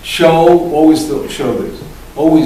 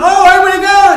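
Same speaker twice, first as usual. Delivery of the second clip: imitating a child suddenly bursting with glee, much higher and louder; gleeful kid voice, high and loud; excited kid; 9 dB louder than usual. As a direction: squeal it like a kid who has just forgotten they were crying. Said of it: "They can be crying one second, and all of a sudden".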